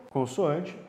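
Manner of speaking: ordinary talk, nothing marked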